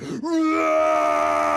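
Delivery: long, loud, high roar; monster roar. A narrator's reading. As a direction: roar to scare them off. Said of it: "he raced after them".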